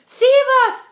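high, loud panicked cry for help; cried out. Acted. Ladybird crying out for rescue, high and loud. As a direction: scream it high and loud in terror. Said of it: "cried the ladybird".

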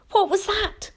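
high, startled question; asked quickly. A narrator's reading. James hears something mid-sentence, asking quickly and startled. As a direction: break off, suddenly alert. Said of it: "he asked quickly".

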